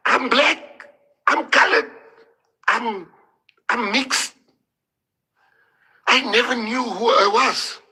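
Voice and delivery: real tears rising as the pitch climbs; emotional, near tears. Real, not acted. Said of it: "I'm even getting emotional".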